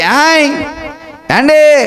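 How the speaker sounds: high, ringing Godavari-style call; Godavari accent. Shown as a demonstration. Preacher showing how Godavari folk talk, Godavari accent. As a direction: demonstrate the Godavari lilt, pitched high. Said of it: "గోదావరికి".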